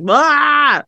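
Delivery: excited outburst. Retold or imitated. Co-host retelling her second-watch reaction, excited outburst. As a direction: blurt a drawn-out mock cry. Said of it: "I was like".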